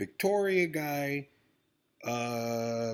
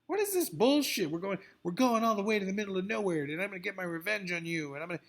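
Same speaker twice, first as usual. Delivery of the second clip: higher, fast, mocking revenge plot; mock heroine voice; 7 semitones higher, faster than usual. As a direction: parody the vengeful heroine, rushed. Mocking the show's premise again.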